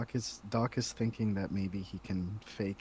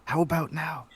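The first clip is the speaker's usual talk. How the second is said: high, loud, hopeful question; cheerfully loud check; eager character; 5 semitones higher, 5 dB louder than usual. Acted. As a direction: call out helpfully, oblivious to the noise. Doc loudly offers a fix.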